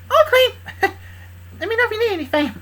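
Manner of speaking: squeaky little sidekick voice, high; tiny high voice. Acted. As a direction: chirp it in a small high voice. Nifty after licking tears.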